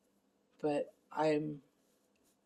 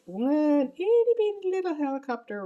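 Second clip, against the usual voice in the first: high, squeaky, mocking voice for the tiny helicopter; cutesy mock; 14 semitones higher, 9 dB louder than usual. Real, not acted. To her viewers, mocking the one small helicopter, high and squeaky. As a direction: belittle it in a squeaky pipsqueak voice.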